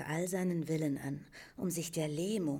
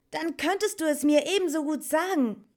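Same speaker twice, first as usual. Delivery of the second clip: fast, high and loud insistence; urgent pressing; 12 semitones higher, 10 dB louder, faster than usual. A narrator's reading. Atreyu presses Morla to reveal what she knows, insistent. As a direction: push them to tell you. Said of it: "drang Atreyu in sie".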